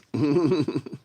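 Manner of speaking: short voiced chuckle. Real, not acted. Sure they won't donate, a short chuckle. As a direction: chuckle to yourself briefly.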